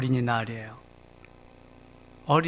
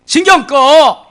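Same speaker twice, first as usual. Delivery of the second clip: loud, high, slow shout; loud drawn-out scolding shout; stern rebuke; 10 semitones higher, 14 dB louder, much slower than usual. Acted. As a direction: snap him out of it. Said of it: "예수님이 막 책망을 하고 있습니다".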